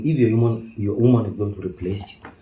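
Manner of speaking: normal talking voice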